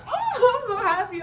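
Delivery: high, gushing delight; high giddy squeal of joy; gushing friend. Retold or imitated. Friend's gushing reaction voiced.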